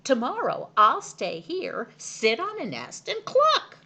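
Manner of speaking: eager peacock. A narrator's reading. Peacock eager, mimics cluck.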